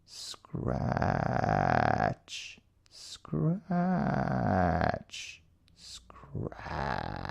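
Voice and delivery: hushed, drawn-out creepy sound words; a spooky whispered scratch, scratch; eerie soft scratching. A narrator's reading. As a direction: breathe them out like something in the dark.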